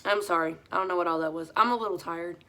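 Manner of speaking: ordinary talk, nothing marked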